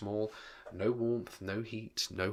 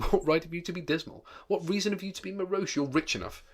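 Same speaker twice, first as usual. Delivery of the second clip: higher, playful retort; gaily teasing; 8 semitones higher, 4 dB louder than usual. Acted. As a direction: toss it back lightly and gaily. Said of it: "returned the nephew gaily".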